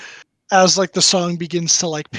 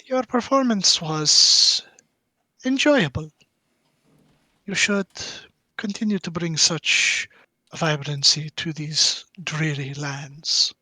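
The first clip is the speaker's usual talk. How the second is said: gracious stranger woman. Acted. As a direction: speak slowly and graciously as the visiting woman, pitched up. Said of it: "she'll hand you five silver pieces and go".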